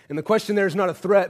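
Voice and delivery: ordinary talk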